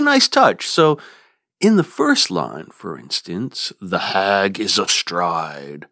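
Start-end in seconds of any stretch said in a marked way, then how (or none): 3.8-5.9 The hag is astride, slow and whispered, beats on hag and stride; stress on hag and stride. Shown as a demonstration. Teacher whispering the line to show its beats, hag and stride stressed. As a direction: breathe it out slowly, leaning on hag and stride. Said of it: "the beats fall on the syllables hag and stride".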